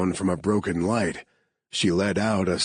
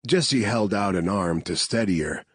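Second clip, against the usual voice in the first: plain reading voice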